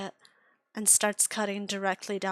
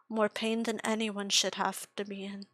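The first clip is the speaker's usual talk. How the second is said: ordinary delivery, nothing marked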